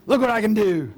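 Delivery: cocky look-at-me boast; showing off. Retold or imitated. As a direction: show off like a proud kid. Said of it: "I think he just wanted to show off".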